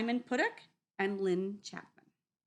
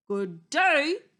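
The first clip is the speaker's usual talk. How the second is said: loud, high and polite; polite wolf greeting; 7 semitones higher, 11 dB louder than usual. Acted. Wolf politely greets Missus Goose, loud and high. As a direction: greet her politely, tipping a hat. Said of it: "most politely".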